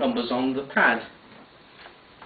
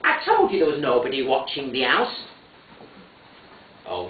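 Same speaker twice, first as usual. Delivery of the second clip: usual speaking voice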